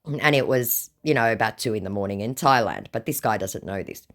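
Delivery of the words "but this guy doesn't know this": ordinary delivery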